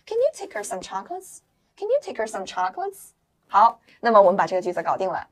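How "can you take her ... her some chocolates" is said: CAN YOU TAKE HER flowing, HER said as "er"; linked together. Shown as a demonstration. Teacher repeats the sentence for learners, linked together. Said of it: "读快了以后不发音".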